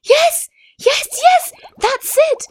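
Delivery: high, joyful little fish voice; a tiny fish bursting with joy. A narrator's reading. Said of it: "jumping for joy".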